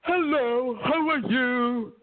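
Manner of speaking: mock the guy claiming he's American; imitating a fake American. Japanese friend's date imitated, mock accent, slow. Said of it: "you know what he talked like?".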